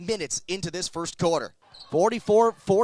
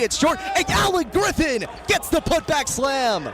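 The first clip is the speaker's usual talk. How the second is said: excited call; 5 semitones higher than usual. Real, not acted. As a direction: cheer the slam as it happens. Putback slam called live.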